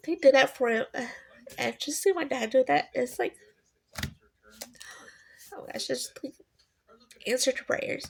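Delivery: tearful, high and trembling; crying. Real, not acted. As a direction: talk through real tears. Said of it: "Okay, I'm crying".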